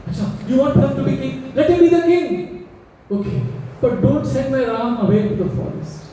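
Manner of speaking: high, quick pleading bargain; bargaining plea. Acted. Dasharath bargaining with Kaikeyi to keep Ram, high and pleading. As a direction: beg and bargain for his son. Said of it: "He starts bargaining".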